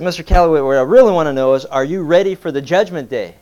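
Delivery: frustrated preacher. Acted. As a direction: lean in hard, fed up with the runaround. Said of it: "the guy was getting real frustrated".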